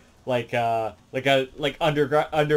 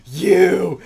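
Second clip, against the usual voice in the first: loud, higher furious yell; angry shout; 7 semitones higher, 11 dB louder than usual. Retold or imitated. The laughing dog returns, a loud mock shout of recognition. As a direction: bark out the accusation in a mock voice. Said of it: "he's like".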